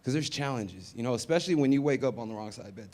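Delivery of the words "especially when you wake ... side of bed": ordinary talk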